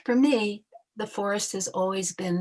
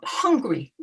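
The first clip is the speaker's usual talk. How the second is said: normal talking voice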